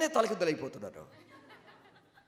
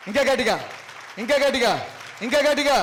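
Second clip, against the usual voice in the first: louder urging; 3 semitones higher, 8 dB louder than usual. Real, not acted. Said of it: "గట్టి కొట్టండి బిడ్డ".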